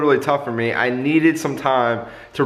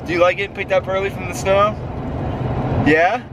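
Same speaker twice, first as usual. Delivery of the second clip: pet talk; 8 semitones higher than usual. Real, not acted. Talking to his dogs in snow.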